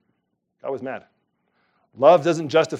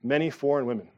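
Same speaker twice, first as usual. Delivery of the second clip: ordinary talk, nothing marked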